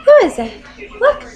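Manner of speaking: coaxing a pet. Real, not acted. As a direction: prompt the bird with a lifted, emphatic question. Coaxing pet bird to look.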